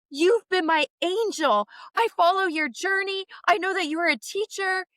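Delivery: high, adoring fan voice; gushing fan. Retold or imitated. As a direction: pour out admiration to an idol. Fan gushing to her idol.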